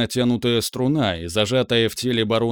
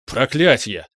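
louder cry of a curse; shouted curse; 4 dB louder, slower than usual. A narrator's reading. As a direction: yell the curse out. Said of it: "выкрикнул Ириан". (выкрикнуть)

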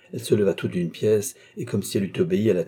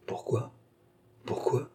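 seething murmur; 4 semitones higher, 8 dB quieter than usual. A narrator's reading. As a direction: mutter it under your breath, seething. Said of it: "murmura monsieur de Beaupréau qui écumait".

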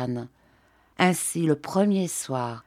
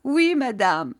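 higher, mildly tearful answer; sobbed reply; 6 semitones higher than usual. A narrator's reading. Maid admitting it to her mistress, mildly sobbing. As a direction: answer with a touch of a sob. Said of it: "Rosalie sanglota".

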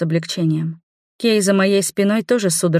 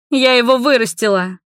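angry cry; 5 semitones higher, 4 dB louder than usual. Acted. Mother shouts back in quarrel.

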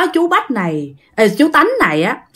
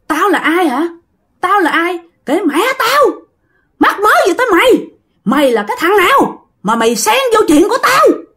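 high-pitched, indignant spirit voice; defiant possessed man; 9 semitones higher than usual. Acted. Possessed man rebuffs the master, high and hostile. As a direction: snap back at the questioner, pitched way up. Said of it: "Bắt đầu nói vậy đó ha".

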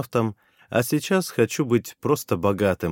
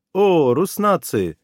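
high, slow, beaming exclamation; delighted greeting; 7 semitones higher, 5 dB louder, slower than usual. Acted. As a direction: greet them with a broad smile. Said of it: "широко улыбнулась она".

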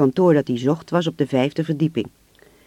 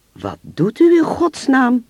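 hoge, luide, ontzette uitroep; geschokt; 9 semitones higher, 5 dB louder, slower than usual. A narrator's reading. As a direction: roep vol ongeloof, traag en luid. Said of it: "staarde hem geschokt aan". (geschokt en verontwaardigd uitroepen)

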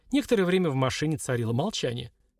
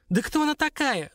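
high-pitched indignant outburst; exasperated cry, much higher pitch; 13 semitones higher, 3 dB louder than usual. A narrator's reading. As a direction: demand it in outrage, voice shooting up. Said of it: "закричала девушка".

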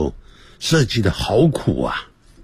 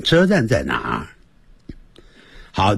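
ordinary delivery

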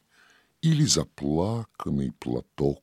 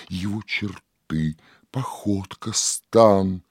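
ordinary delivery

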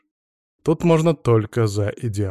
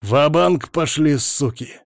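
commanding shout; 3 semitones higher, slower than usual. A narrator's reading. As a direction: bark the retreat order. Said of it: "скомандовал Сажен".

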